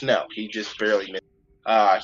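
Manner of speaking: ordinary talk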